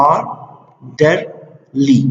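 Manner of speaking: آرډرلي very slowly, split as ar, der, lee with pauses; syllable by syllable. Shown as a demonstration. Teacher showing the syllables in a word, آرډرلي said syllable by syllable. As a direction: break آرډرلي into three separated beats. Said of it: "دې کې سیلیبلز دي".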